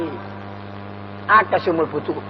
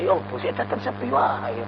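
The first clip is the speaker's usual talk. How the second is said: muttered complaint; 9 semitones lower, 11 dB quieter than usual. Retold or imitated. Mimics trader complaining, market quiet.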